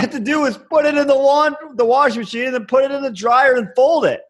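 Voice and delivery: high-pitched mock exasperation; exasperated rant. New stew ranting about the laundry room, acted exasperation.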